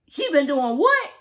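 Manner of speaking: loud, high, incredulous; Maddie's outrage. Retold or imitated. Vlogger voicing Maddie's shocked reaction, loud and incredulous. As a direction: demand it in disbelief.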